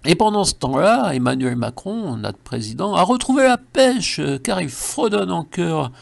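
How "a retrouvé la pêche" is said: perky burst. Acted. Comic excitement about Macron.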